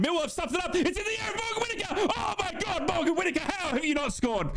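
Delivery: high excited commentary yell; excited shout. Real, not acted. Big chance in live commentary.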